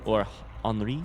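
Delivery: Henri said the French way, silent h. Shown as a demonstration. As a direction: Henri as ahn-ree. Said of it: "I think we might be Henry".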